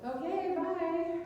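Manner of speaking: high, breezy, uncaring sign-off; mock flippant goodbye. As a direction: toss off the goodbye like it doesn't matter. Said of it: "They said".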